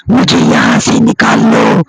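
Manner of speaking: low breathy ghost whisper, begging; a trapped spirit whispering from inside the house; ghostly whisper. Acted. Hidden witch girl calls out in a low eerie whisper. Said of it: "उसे एक आवाज सुनाई देती है".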